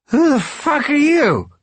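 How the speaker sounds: put-on loud, very high drunken slur; slurred drunk demanding in a high-pitched yelp. A narrator's reading. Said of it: "he says in a high, slurred voice".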